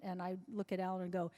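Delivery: ordinary talk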